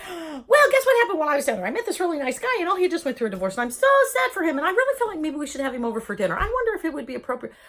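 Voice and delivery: volcano of words. Retold or imitated. Patient rambling to family after the procedure, fast gush. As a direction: pour it all out breathlessly fast. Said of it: "I just was like a volcano of words".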